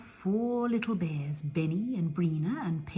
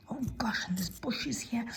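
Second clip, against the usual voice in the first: ordinary delivery, nothing marked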